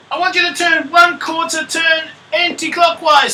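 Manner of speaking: high, loud call, easing off at the end. Acted. Teacher voices a pony instructing her friend, calling out. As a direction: call the instruction across a hillside. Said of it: "Rosette calls out".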